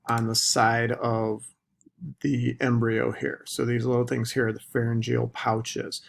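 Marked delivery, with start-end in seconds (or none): none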